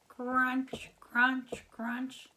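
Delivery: loud, high crunch, crunch, crunch; crunching sound. Pretend. Teacher acting the frog munching a moth, loud crunches. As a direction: chomp it out loud like chips.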